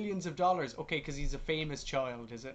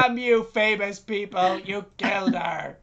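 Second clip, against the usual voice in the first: loud, raised, drawn-out curse; mock outrage; 4 semitones higher, 8 dB louder than usual. Parody of Romeo blaming Hollywood, mock outrage. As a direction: shake a verbal fist in hammed-up outrage.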